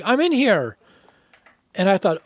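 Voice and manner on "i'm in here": higher-pitched woman calling out; imitated woman's voice. Bandmate retelling a bathroom intrusion, imitated higher woman's voice. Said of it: "I heard this woman's voice go".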